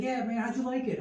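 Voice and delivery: put-on be a proud small boy asking; eager kid. Keychain kid asking dad, eager high kid voice. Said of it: "He's like".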